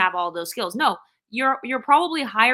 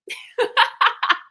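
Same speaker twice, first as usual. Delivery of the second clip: real laugh; 13 semitones higher, 4 dB louder than usual. Real, not acted. Laughs at her own trolling.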